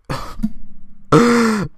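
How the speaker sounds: quick amused laugh; a burst of laughter; short laugh. Real, not acted. Laugh alone on line.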